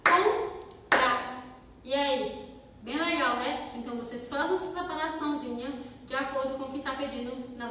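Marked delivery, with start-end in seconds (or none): 0.0-1.5 "Comprar" split into two loud beats, COM-PRAR; syllable by syllable. Shown as a demonstration. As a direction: clap out "Comprar". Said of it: "E comprar".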